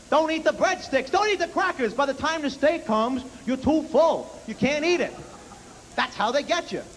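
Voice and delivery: dad warning son about the free bread; imitating grumbling dad. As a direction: complain about the restaurant like a swindle. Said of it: "there's no pleasing dad".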